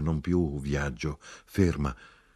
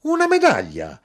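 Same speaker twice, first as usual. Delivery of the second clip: high, loud astonished cry; very high, incredulous outcry; shouted; 9 dB louder than usual. A narrator's reading. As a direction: shriek the question in amazement. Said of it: "mia madre gridò".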